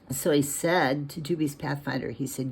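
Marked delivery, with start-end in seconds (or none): none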